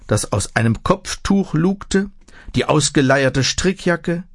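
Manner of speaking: usual speaking voice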